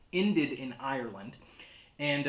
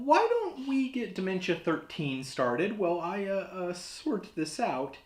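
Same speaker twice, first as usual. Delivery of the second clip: rattled, voice pitched up high; nervous; 8 semitones higher than usual. Acted. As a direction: shaken host pushing on nervously. Rattled by death threat.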